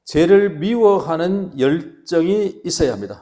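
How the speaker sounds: loud, slow, stretched-out proclamation; preacher's slogan. Shown as a demonstration. Pastor announcing the first point to the congregation, slow loud proclamation. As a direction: declare it slowly and forcefully for repeating. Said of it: "한번 따라합시다".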